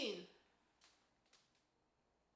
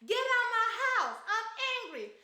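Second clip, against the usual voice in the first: higher, louder hammed-up yell; mock furious yell; 6 semitones higher, 5 dB louder than usual. Comic voicing Eva throwing Dallas out, mock yell. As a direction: shout it like an enraged wife. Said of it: "Eva gonna be like".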